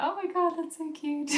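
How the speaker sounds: put-on soft high cooing over a baby. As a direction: coo like a delighted nurse. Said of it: "they were cooing".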